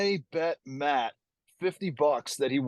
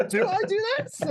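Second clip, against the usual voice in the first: very high joking uptalk on "Do I do this"; exaggerated upward inflection. Shown as a demonstration. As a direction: push "Do I do this" way up into a question. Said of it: "You go up".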